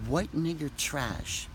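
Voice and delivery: muttered softly and slowly; under her breath. Retold or imitated. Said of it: "under her breath".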